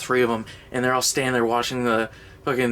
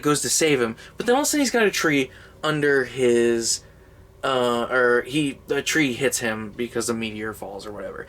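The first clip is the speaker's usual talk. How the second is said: normal talking voice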